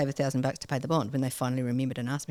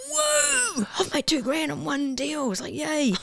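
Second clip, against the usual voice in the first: much higher, whooping cry of joy; retold elation; 10 semitones higher, slower than usual. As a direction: celebrate two grand like a win. Retold joy at first deal.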